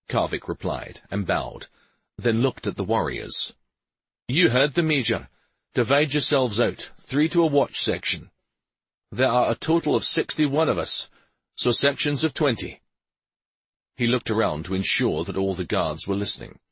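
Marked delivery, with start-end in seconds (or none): 4.3-12.8 higher, projected officer's voice; officer giving orders. A narrator's reading. Officer dividing warriors into watch sections, giving orders. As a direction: pass the orders down firmly.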